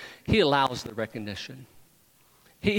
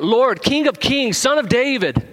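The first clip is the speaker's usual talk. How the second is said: loud, high, drawn-out acclamation; shouting out; 10 semitones higher, 7 dB louder than usual. Retold or imitated. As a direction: proclaim it loudly like a shouting crowd. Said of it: "they're shouting out".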